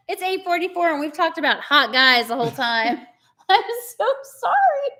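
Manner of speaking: high, giggly, embarrassed laugh-talk; laughing apology. Real, not acted. Host owns up to viewers about the hot-guy chat, laughing apologetically.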